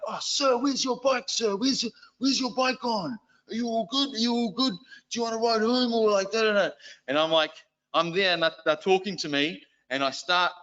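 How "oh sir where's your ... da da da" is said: high, mock-caring teen boy voice; imitating teen boys. Cool car boys fussing over their teacher, mock-caring high voice. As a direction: ham up the fussing schoolboys.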